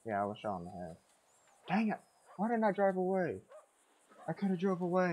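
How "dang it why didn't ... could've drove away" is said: higher-pitched, play-acted frustration; exasperated regret. Player just shot in the head, exasperated at himself. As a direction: kick yourself aloud, pitched well up.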